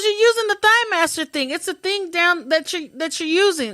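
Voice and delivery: high, pushy imitation of the woman; loud insistent explaining. Patient explaining to the lady next to her, loud imitation.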